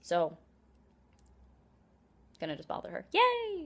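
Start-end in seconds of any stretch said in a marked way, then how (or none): 3.1-3.7 high, loud yay; loud, high-pitched burst of glee; gleeful cheer. Real, not acted. Cheering before bothering her friend.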